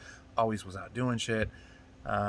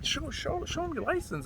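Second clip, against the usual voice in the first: put-on whiny teen. Kid urging the girl.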